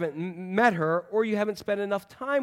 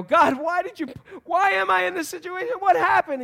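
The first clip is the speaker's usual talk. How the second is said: much higher, louder plaintive whine at God; mock whiny complaint; 11 semitones higher, 4 dB louder than usual. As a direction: play the lost believer, bewildered and whining. Acted believer complaining to God.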